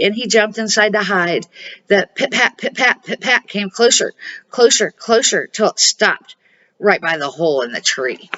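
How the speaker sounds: ordinary delivery, nothing marked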